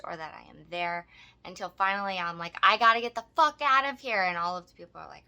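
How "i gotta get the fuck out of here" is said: loud, higher-pitched burst of frustration; exasperated outburst. Retold or imitated. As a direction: blurt it loudly, desperate to get out. Finally insisting on leaving.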